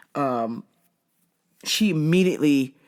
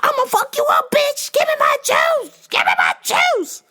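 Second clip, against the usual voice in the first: shrieked threats, much higher and louder; threatening screams; 4 dB louder than usual. Retold or imitated. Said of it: "girl's like".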